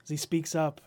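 ordinary delivery

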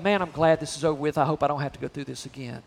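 low, quiet, fast mumble of relief; desperate plea. Retold or imitated. The desperate sufferer glad it's over, quiet and fast. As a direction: mutter it like someone barely getting through. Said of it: "that desperate plea of".

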